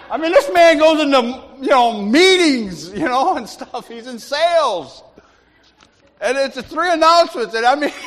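high, loud comic exasperation; incredulous ranting. Real, not acted. Preacher teasing salesman Ray over three announcements, incredulous rant. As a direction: stretch key words in amused disbelief.

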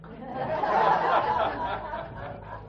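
an airy laugh climbing high; high breathy laugh. Real, not acted. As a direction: let the laugh float up. Laughs at Flushing offer.

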